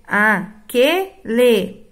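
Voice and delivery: aquele drawn out very slowly; slow example word. Shown as a demonstration. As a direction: stretch aquele for the class. (palavra bem pausada)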